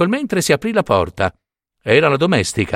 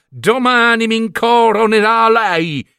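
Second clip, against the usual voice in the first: higher, slow sly scheming; a feather duster's crafty aside; scheming thought; 7 semitones higher, 3 dB louder than usual. Acted. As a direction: plot it out loud, sly and drawn out.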